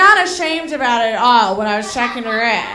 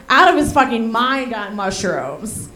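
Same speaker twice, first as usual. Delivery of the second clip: ordinary delivery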